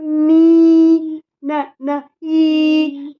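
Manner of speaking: "नी" held long, then short "न, न" and a long "ई"; sounding out. Shown as a demonstration. Mother breaks knee into sounds, long ee. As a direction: break "नी" into quick n and stretched ee. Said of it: "इसे बोलते हैं कैसे अपन".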